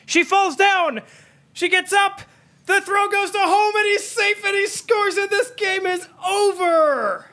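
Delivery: excited shouting play-by-play; frantic sports commentary. Real, not acted. Frantic call of a walk-off play.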